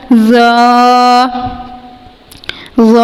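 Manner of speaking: ظ (written ز) with the tongue tip out, full-mouthed; heavy letter demo. Shown as a demonstration. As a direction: put the tongue out for ز. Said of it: "زبان کا سرا تھوڑا سا باہر نکال کر". (ز زبان باہر نکال کر، پر)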